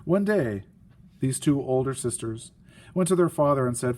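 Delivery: plain reading voice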